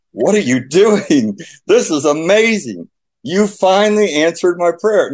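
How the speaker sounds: retold wonder, pitch climbing high; amazed prayer. Father retelling his prayer as his son cries, amazed. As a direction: marvel at an answered prayer, rising as it goes.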